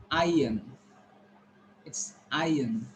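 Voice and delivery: iron, said EYE-un without an R; correct pronunciation. Shown as a demonstration. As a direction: say "iron" as EYE-un, falling, R silent. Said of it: "how we should pronounce this".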